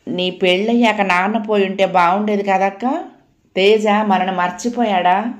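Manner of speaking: usual speaking voice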